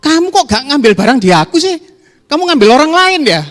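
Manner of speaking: high, loud jealous whine; sulky supplier complaint. Retold or imitated. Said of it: "Dia ngamuknya beda gini".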